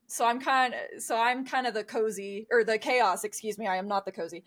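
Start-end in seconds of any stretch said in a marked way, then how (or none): none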